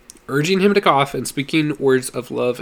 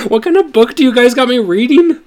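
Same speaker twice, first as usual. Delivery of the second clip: much higher, louder, laughing protest; laughing disbelief; 11 semitones higher, 9 dB louder than usual. Real, not acted. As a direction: blurt it out, amazed and laughing. Reader breaks character, overwhelmed.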